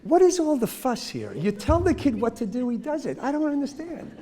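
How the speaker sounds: a hint of a self-satisfied mom; lightly voiced smug parent; smug mother. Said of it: "the mother of the easy child says".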